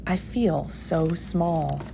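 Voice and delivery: a hushed, awed little admission; small awed voice. A narrator's reading. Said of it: "she said to the whale".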